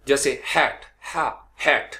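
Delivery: हैट said with a breathy throat h. Shown as a demonstration. Teacher showing students the throat h in हैट. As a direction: push हा from the throat. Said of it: "हलक से".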